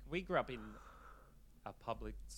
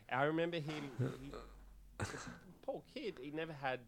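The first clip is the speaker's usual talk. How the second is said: ordinary delivery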